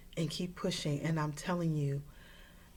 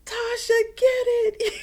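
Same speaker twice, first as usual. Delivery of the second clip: much higher, much louder cheering yell; shouted encouragement from a running partner; coach shouting; 10 dB louder than usual. Retold or imitated. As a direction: cheer her on from behind at full voice. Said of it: "he started shouting behind me".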